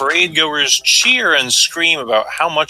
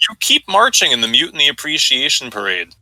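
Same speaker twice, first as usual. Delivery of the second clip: ordinary delivery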